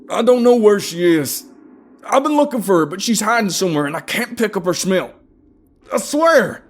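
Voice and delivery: loud, high agonised cry; frantic shouted begging; desperate pleading. A narrator's reading. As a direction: plead in pain and panic. Tortured man pleading for his life.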